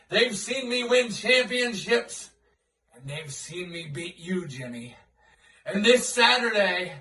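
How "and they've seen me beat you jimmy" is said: menacing hush. Acted. Taunting promo to rival.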